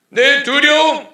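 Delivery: booming high sermon shout, slow at first; full-volume preacher's call; preaching at full force. Acted. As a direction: cry it out from the pulpit. Preacher cries out.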